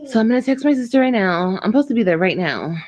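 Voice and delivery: normal talking voice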